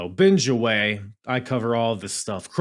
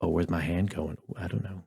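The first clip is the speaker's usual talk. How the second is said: mock seductive; 4 semitones lower, 7 dB quieter than usual.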